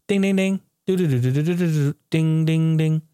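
sung slot machine ding-ding noises; slot machine jingle. Retold or imitated. Vlogger mimicking a slot machine, singsong dings.

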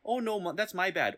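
hammed-up high apology; apologetic Canadian. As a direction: squeak out a sorry like the politest Canadian. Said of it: "everybody would be like".

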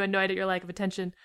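usual speaking voice

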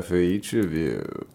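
slow, low gruff wolf; rough wolf voice. Acted. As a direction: growl it like a wolf pretending. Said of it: "She's got a very deep voice".

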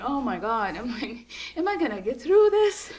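high, rising mock panic; panicked self-quote. Runner retelling her fear in the dark, panicked.

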